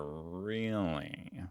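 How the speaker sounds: REALLY stretched long and soft, rising at the end; drawn-out questioning. Acted. Presses the suspicious question again.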